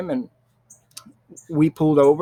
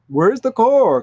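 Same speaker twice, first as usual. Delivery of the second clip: high and louder, demanding the car; alarmed shout; 8 semitones higher, 6 dB louder, slower than usual. Retold or imitated. Grandma at home asks where the car is, shouting. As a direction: bark the question at the family. Family waking up, car missing.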